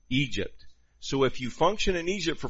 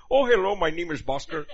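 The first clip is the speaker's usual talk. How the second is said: playfully imitating an Indian accent; mock Indian accent; 6 semitones higher than usual. Pastor imitating an Indian kid's accent to church. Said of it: "I'm expecting to go".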